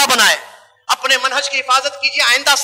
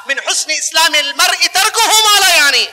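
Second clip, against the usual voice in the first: slow, raised Arabic recitation of a saying; hadith recitation; 4 semitones higher, slower than usual. Real, not acted. Preacher reciting hadith to the audience, slow chant. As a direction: intone the Prophet's words slowly.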